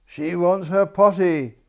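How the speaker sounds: king crying out. A narrator's reading. King calling for the potty, loudly. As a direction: boom out the alarm. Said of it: "cried the king".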